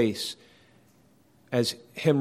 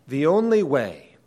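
"only" punched louder in "The only way"; stress on only; 3 semitones higher, 5 dB louder than usual. Real, not acted. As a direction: lean hard on "only", raised in pitch and volume. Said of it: "and I'm emphasizing".

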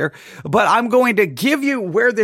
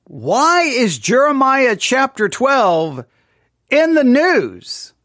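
slow dramatic question; 4 semitones higher, much slower than usual. Pretend.

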